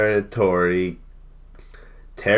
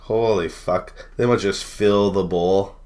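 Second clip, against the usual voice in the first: ordinary talk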